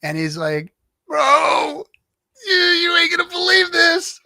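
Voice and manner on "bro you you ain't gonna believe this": much higher, louder words bursting through laughter; can barely get it out for laughing; laughing hysterically. Retold or imitated. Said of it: "he's laughing his ass off".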